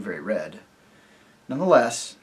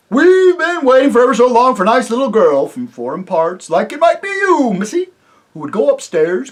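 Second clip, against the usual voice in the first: high, loud, hearty rustic voice with a chuckle; jovial rustic chief, laughing as he talks; chief of the invisible folk; 6 semitones higher, 9 dB louder than usual. Acted. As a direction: boom it out like a jolly simple village chief. Chief voice threatens cheerfully.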